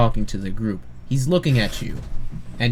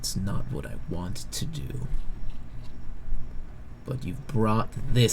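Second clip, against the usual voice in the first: grave and quiet; 7 dB quieter than usual. Acted. NPC looks down at the player and answers, quiet and grave.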